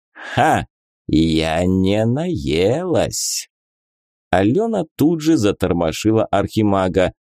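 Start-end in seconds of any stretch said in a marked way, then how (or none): 0.1-3.5 slow, low menacing book creature; sinister monster voice. A narrator's reading. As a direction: snap it out like a hungry beast. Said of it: "зловеще щёлкнула зубами и заявила".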